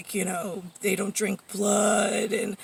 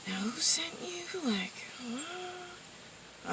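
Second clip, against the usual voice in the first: whispered, higher, under the breath; imitated whisper of someone wary; mock whisper; 8 semitones higher, 13 dB quieter, much slower than usual. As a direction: hush it like a suspicious stranger. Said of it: "trying to, like".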